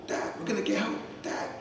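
put-on high, eager kid's voice on the phone; a child calling his dad, breathless with news; excited son. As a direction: blurt out the good news like an eager boy.

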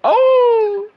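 high, loud burst of delight; excited yelp. Real, not acted. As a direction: shout it out, thrilled. Laughing at own highlight.

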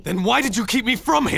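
high, loud challenge; angry demand. Acted. Hero demanding why she held him back, angry and high. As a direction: demand an answer.

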